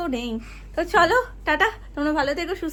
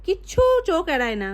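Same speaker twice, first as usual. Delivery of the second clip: usual speaking voice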